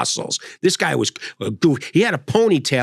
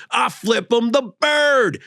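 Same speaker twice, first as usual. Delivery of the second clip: gleeful trucker; 8 semitones higher, slower than usual. Retold or imitated. Trucker boasting.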